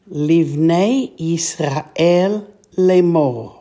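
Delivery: slow, drawn-out Hebrew phrase; slow Hebrew reading. Shown as a demonstration. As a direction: read it slowly, word by word. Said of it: "all together".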